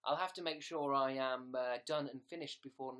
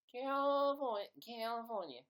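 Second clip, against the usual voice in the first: mock Californian accent; 7 semitones higher, 3 dB louder than usual. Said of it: "What else is there in America?".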